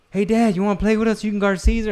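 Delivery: put-on high, eager kid inviting dad to play; daughter's voice. Daughter asks dad to join the game, high eager kid voice. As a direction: chirp it like a hopeful little girl. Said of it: "Mickey's like".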